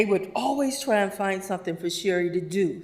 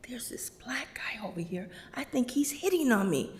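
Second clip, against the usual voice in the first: much softer, hushed report; whispered worry; 5 semitones higher, 6 dB quieter than usual. Retold or imitated. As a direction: whisper it so he won't hear. Terry whispering on phone.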